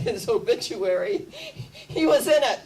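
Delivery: laughing through the words. Real, not acted. Lecturer amused by Nobel's obituary, laughing.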